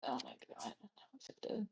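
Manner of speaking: breathless awed whisper. Real, not acted. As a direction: hush it out in awe. Awed reaction at the table.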